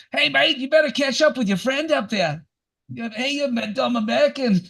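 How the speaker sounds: high, mocking Australian accent, a laugh breaking through; Australian heckler accent. A guy on the beach yelling at the narrator, in mock Australian accent. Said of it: "one guy yells out to me".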